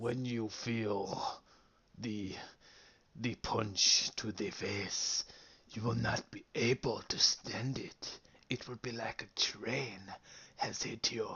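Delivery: slow, drawn-out villain threat; menacing supervillain monologue, slowed right down. Retold or imitated. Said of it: "He does sound like a supervillain".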